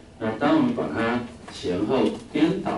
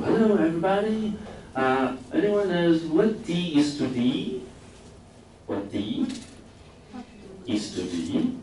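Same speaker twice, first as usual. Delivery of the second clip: much higher, slow, enthusiastic British teacher greeting; cheery British teacher; 10 semitones higher, slower than usual. Retold or imitated. British teacher greeting the class, high and cheery. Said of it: "一进来就跟大家打招呼，非常热情".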